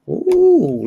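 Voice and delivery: sudden high, loud ooh of surprise; a startled squeal at the screen; surprised ooh. Real, not acted. Host notices the camera change, surprised high ooh.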